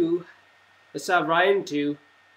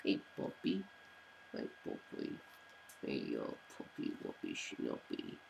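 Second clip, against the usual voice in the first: soft whispered puppy talk, much quieter; 16 dB quieter than usual. Real, not acted. Owner cooing to puppy Nico, whispered baby talk. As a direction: coo it under your breath to the puppy.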